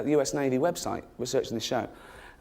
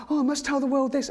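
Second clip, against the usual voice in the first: higher, mock-urgent trivia nerd; mock panicked trivia fan; 13 semitones higher, 3 dB louder than usual. Trivia poster waking in a cold sweat, mock-urgent higher voice. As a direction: gasp it out like a fake emergency. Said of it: "wake up in a cold sweat and go".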